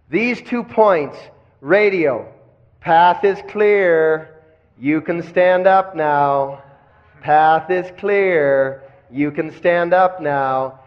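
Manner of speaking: 1 slow guiding. Shown as a demonstration.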